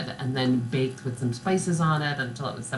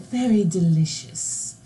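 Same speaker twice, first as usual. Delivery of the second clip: slow, higher mock British voice; monotone British accent; 3 semitones higher, slower than usual. Co-host echoing the baking show judge, monotone British accent. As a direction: draw it out in a fake British accent. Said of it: "very monotone British".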